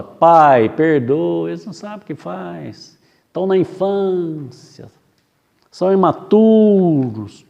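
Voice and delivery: a gentle hint of Jesus begging forgiveness; pleading Jesus. Jesus on the cross asking the Father, mildly pleading. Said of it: "Por isso que Jesus fala".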